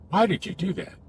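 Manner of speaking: high-pitched accusing question; judgmental. Shown as a demonstration. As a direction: ask it as if finding fault. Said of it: "it often comes across as judgmental".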